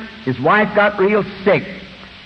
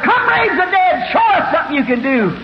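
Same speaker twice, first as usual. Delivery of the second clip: high, challenging crowd shout; hollering heckler; 8 semitones higher than usual. Acted. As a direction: holler it high as a taunting onlooker. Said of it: "The other hollered".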